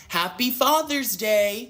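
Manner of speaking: put-on high, slow child's greeting; imitated young child. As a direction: imitate a little kid, pitched well up. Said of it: "a video of a mixed child saying".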